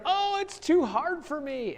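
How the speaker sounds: high, soft whining complaint; whiny Cain. Acted. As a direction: whine like a self-pitying child. Cain whines to God in story.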